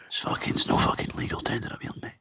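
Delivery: quick whispered refusal in character; hushed mock refusal. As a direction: whisper it as a local turning money away. Acting local refusing English money.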